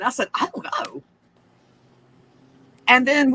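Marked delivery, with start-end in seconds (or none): 0.3-1.0 breathy whispered reply; hushed whisper. Retold or imitated. Daughter answers mom under the barking in a whisper. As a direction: whisper it, spooked.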